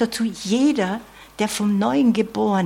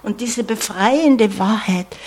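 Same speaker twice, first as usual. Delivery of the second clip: diese befreiende Wahrheit proclaimed louder, stress on befreiende; proclaimed loudly; 3 dB louder than usual. Real, not acted. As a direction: ring out befreiende with full voice. Same phrase, hushed then loud. (laut verkündet, Betonung auf „befreiende“)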